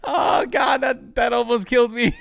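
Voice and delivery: high, loud shaken exclaim; excited. Real, not acted. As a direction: gasp out the near miss. Shaken after grenade.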